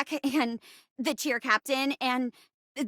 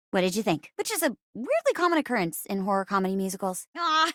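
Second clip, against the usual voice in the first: ordinary talk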